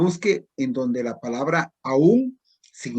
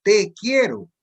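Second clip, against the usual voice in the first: "Te" said higher and louder as té, tea, in "Te quiero"; stress on té; 6 semitones higher, 5 dB louder, slower than usual. Shown as a demonstration. Teacher asking for a cup of té, loud high "Te". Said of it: "yo le estoy pidiendo una taza de té".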